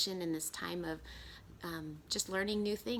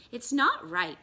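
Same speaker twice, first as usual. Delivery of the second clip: muttered; 10 semitones higher, 10 dB louder, slower than usual. Acted. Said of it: "he muttered to himself".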